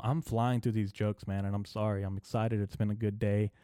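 ordinary talk, nothing marked

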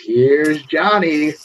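very slow, stretched announcer-like call; retold scream. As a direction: imitate the famous scream at moderate volume. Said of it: "and screams".

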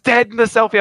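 usual speaking voice

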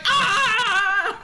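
short high imitated scream of agony; mock agonized scream. Recapping the actress's anguish to her cohost, high mock scream. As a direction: mimic the actress wailing through the credits. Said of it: "All you hear is".

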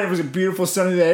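ordinary delivery

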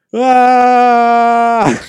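long, loud, high mock scream; hammed-up bad-acting yell held on one note; fake yell. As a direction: yell it like a terrible actor. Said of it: "it's like fake yell".

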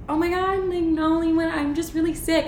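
high, flustered brush-off; flustered denial. Retold or imitated. Denying throwing up to her friend, high flustered brush-off. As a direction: deny it fast, a little panicked.

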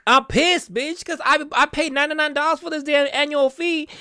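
pissed-off rant. Real, not acted. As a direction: let the anger boil over.

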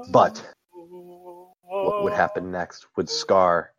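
slow, high, ominous build; ominous storyteller voice. Acted. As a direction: stretch it out like a spooky tale. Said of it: "best storyteller voice".

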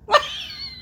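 short high burst of laughter; real squealed laugh. Real, not acted. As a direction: crack up right after the line. Laughing at own story.

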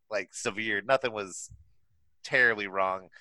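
ordinary delivery

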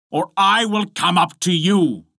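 high, called-out threat; threatening cry. A narrator's reading. Husband threatening to climb up, crying out. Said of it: "cried Bluebeard".

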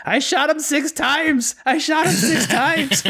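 high, slow, drawn-out mock quote. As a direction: stretch it out like a spooked old doctor. Quoting the movie doctor.

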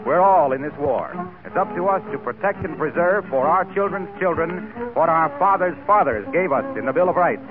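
ordinary talk, nothing marked

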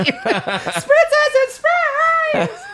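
high-pitched, drawn-out mock scream; hammed-up long squeal, imitating a co-host's ad read; Manscaped review impression. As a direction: stretch it into a silly high screech while laughing. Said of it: "You remind me of when you do the Manscaped review".

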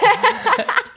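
a high, bursting laugh; high burst of laughter. Real, not acted. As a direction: crack up at the name. Laughs at the nickname.

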